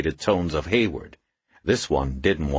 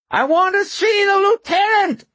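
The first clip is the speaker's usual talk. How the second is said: thin, reedy, very high screech; frantic high-pitched demand; screeching homeless man; 5 dB louder than usual. A narrator's reading. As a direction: screech it loudly in a reedy falsetto. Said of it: "screeched in a thin, reedy voice".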